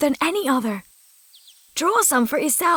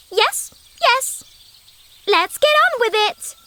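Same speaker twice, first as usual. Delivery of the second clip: much higher, brusque and hurried; impatient; 8 semitones higher, slower than usual. Acted. Sophie hurries the old woman at the well, high and impatient. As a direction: roll your eyes and hurry it along.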